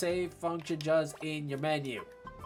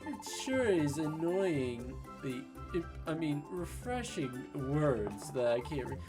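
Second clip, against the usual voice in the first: lightly voiced upbeat companion; cheerful game character; 5 semitones higher, slower than usual. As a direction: read the partner's lines brightly. Reads game partner's lines.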